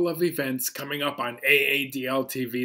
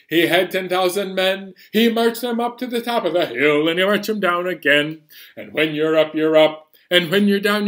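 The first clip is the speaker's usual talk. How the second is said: faster sing-along, rushing the lines; same nursery song, quicker tempo; sped up; 3 semitones higher, 4 dB louder, faster than usual. Shown as a demonstration. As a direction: speed the Duke of York song up a tad. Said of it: "I think we can speed things up".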